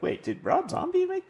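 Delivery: high, startled question; suddenly realizing, surprised. Retold or imitated. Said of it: "You might guess, like".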